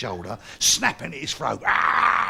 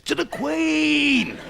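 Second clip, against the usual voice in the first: ordinary talk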